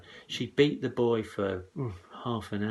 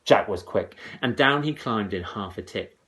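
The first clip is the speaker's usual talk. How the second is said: ordinary delivery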